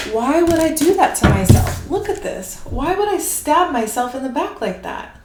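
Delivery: loud opening, then high-pitched protest; inner child's mindset. Acted. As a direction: question it like an affronted child. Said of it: "get into that mindset of".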